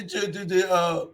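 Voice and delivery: usual speaking voice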